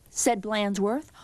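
ordinary delivery, nothing marked

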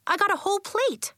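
put-on high, eager little girl; bubbly and proud; bright. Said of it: "said Nori brightly".

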